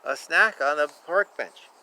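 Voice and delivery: usual speaking voice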